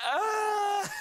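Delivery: high squealing fan shriek; hammed-up girlish squeal; mock fan scream. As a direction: squeal like a starstruck girl. Imitating girls screaming at video.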